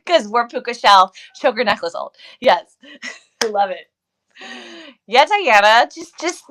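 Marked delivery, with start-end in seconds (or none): none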